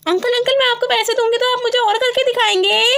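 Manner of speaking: put-on high, eager child voice; high childish voice, excited; excited little girl. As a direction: play the little daughter asking mom. Child voice asks.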